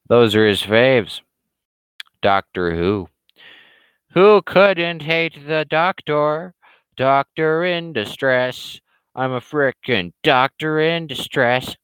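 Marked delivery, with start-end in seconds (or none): 4.1-11.8 mocking. Mocking Doctor Who.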